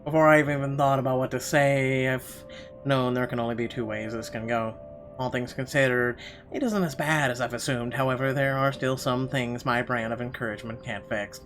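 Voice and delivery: ordinary delivery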